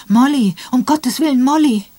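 loud, high desperate shout of her name; shouting for Molly in alarm; frantic calling out. Acted. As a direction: call out to her across the water in panic. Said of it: "hörte sie ihn rufen".

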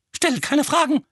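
high, sharp snap; snapping. Acted. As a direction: snap it shut. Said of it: "schnappte Tante Petunia".

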